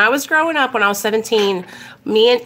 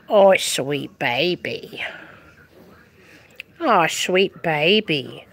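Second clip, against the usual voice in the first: slow, low, crooning pet talk; 4 semitones lower, slower than usual. Real, not acted. Owner admiring the dog's big belly, doting croon. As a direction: croon tenderly to a beloved dog, drawing out words. Baby-talking to her dog.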